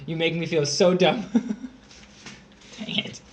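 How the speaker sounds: ordinary talk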